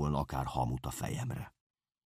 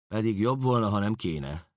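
ordinary delivery

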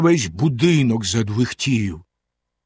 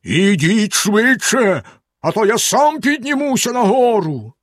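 terrible voice; 8 semitones higher than usual. A narrator's reading. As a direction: drag out the threat like a monster below. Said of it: "заволав страшним голосом".

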